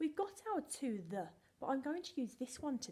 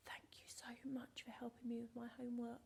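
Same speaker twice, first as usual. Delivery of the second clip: soft unvoiced whispered thanks; a whispered thank you; 8 dB quieter than usual.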